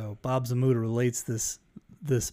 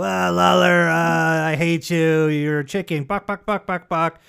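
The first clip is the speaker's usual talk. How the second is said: mocking heckle ending in chicken clucks; taunting chicken clucks; 2 semitones higher, 4 dB louder than usual. Jim Carrey taunting Lawler in the ring, mocking clucks. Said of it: "Jim Carrey would go".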